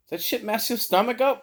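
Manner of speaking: high-pitched imitation of a coworker asking; coworker's question. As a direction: ask it high, with a questioning lilt. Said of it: "One of my other coworkers was like".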